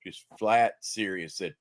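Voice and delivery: usual speaking voice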